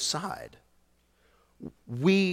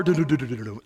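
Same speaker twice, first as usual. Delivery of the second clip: imitating bass guitar; 3 semitones lower, much faster than usual. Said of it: "you just hear the bass guitar".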